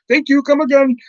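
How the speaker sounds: high, singsong mock Indian accent; exaggerated Indian-accented farewell. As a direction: chirp it in a comic Indian accent. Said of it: "generic Indian character".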